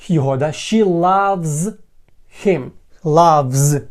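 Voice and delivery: ordinary talk, nothing marked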